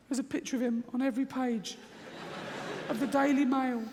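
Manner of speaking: high, panicky inner voice blurting it out; a squeaky scared voice in his head; fearful inner voice. Retold or imitated. Comedian voicing the scared side of his mind, high and panicky. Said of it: "this one goes".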